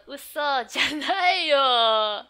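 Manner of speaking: slow, loud cry of "Uso janai yo!"; drawn-out loud kid protest; indignant shout. Acted. Reads manga girl's shout.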